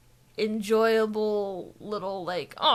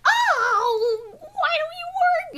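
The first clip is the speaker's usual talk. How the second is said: very high, loud exasperated wail; Misty; 5 dB louder, much slower than usual. Retold or imitated. Imitating a trainer whose Pokemon won't obey, exasperated wail. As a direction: whine in hammed-up cartoon frustration. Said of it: "then she'd be like".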